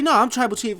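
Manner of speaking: high, loud mock-angry insistence; Roman Reigns. Wrestler arguing over who is chief, mock mad. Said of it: "Roman Reigns is getting so mad".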